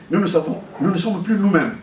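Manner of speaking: normal talking voice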